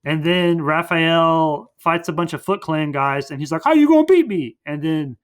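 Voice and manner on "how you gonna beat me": high, loud challenge; exaggerated cartoon bravado; mock cocky taunt. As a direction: throw out a hammed-up fighter's taunt. Raphael taunting his foes, retold.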